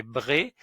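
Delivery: bré ending on a closed é; syllable bré. Shown as a demonstration. Teacher showing how é sounds after br. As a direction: say bré clearly. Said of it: "avec un E accent aigu, ça fait".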